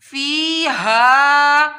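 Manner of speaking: فیہا with both long vowels drawn out very slowly; stretched recitation. Shown as a demonstration. Teacher slowly stretching فیہا in recitation. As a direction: hold فی and ہا each a full count. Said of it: "اس کو ایک الف کے برابر کھینچا جائے گا".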